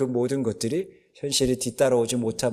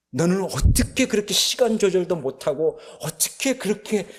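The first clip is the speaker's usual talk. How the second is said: higher, a bit louder exasperated scolding; retold furious scolding; 7 semitones higher, 3 dB louder than usual. Father berating son who missed the interview, angry scolding. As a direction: vent a father's fury at his son. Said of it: "그 분노".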